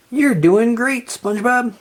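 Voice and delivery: high, bright cheer; root for him. A narrator's reading. Patrick cheering SpongeBob's practice, excited. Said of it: "Patrick cheered".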